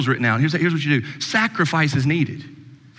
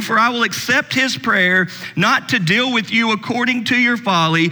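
higher-pitched, emphatic Bible reading; scripture reading; 5 semitones higher than usual. Acted. Preacher reads God's words to the congregation, emphatic scripture reading. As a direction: read the verse out with rising intensity.

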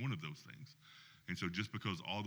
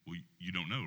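normal talking voice

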